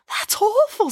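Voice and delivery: high-voiced dismay; appalled girl. A narrator's reading. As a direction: recoil at the news like a horrified child. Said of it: "said Samantha".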